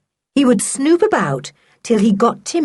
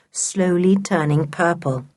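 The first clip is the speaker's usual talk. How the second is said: usual speaking voice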